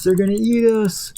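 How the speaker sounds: rising alarm, hammed up; mock panic. Little food warning the others, high mock panic.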